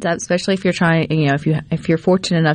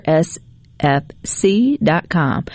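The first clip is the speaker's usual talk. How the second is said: slow spelled-out address; much slower than usual. Shown as a demonstration. Repeating the web address.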